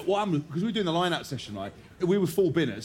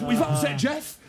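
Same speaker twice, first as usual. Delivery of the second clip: higher, sing-song "We've upset Jeff"; sing-song warning; 9 semitones higher, slower than usual. Retold or imitated. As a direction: chant it like a playground taunt. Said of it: "he goes".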